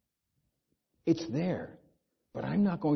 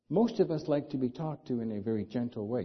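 ordinary talk, nothing marked

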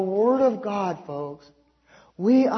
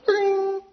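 phone ring; 11 semitones higher than usual. Retold or imitated. Phone rang, he imitates it.

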